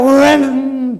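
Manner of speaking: vocal noise of a car racing past; imitated car zoom.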